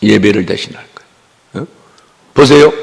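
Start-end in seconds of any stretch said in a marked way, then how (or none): none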